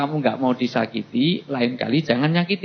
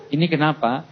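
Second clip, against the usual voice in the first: usual speaking voice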